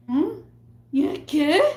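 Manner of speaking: high, questioning mimicry; mocking high-pitched puzzlement; bewildered customer. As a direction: mimic a clueless customer, voice jumping up.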